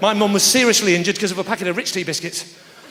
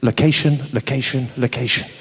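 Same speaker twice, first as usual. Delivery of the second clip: Kirsty Allsopp impression; 8 semitones lower than usual. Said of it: "Kirsty Allsopp always says".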